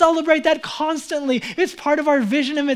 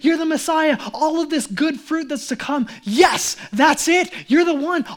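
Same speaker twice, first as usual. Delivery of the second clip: elated, raised-pitch hailing; excited acclaim; 3 semitones higher than usual. Acted. Disciples hail Jesus as the one, excited. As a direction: hail him with rising excitement. Everyone's excited thought about Messiah acted.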